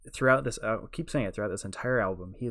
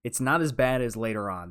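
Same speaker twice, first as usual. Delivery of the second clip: normal talking voice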